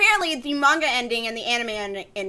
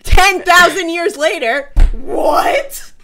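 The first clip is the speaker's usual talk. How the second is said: loud, drawn-out disbelief; aghast and louder; incredulous outburst; 4 semitones higher, 8 dB louder, much slower than usual. Acted. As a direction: slowly repeat it in outrage, then burst out with "what". Outraged retelling of the ending.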